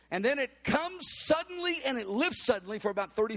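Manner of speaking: ordinary delivery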